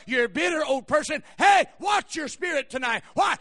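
urgent sermon building to a shout; impassioned preaching. Real, not acted. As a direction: drive the warning home to the congregation. Preaches at full force.

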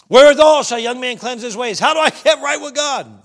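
high, shouted preacher questions; impassioned questioning. Acted. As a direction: cry out as one seeking an answer. Cries out the seeker's questions.

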